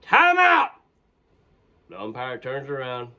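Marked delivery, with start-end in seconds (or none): 0.0-0.7 high, loud call across the field; shouting timeout. Retold or imitated. Player yelling to the umpire, loud high call. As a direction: yell it to stop play.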